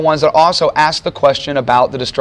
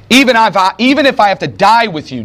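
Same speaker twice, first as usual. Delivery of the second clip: emphatic insistence; 7 semitones higher than usual. A narrator's reading. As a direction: protest it hard, like Peter swearing loyalty. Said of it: "But Peter insisted emphatically".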